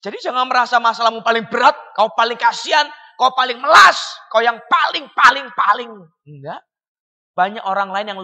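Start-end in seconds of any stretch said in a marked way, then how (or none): 1.9-5.9 loud mocking rise on melas; scornful shout. Preacher scolding self-pitying listeners, loud mocking shout. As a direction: throw their self-pity back at them.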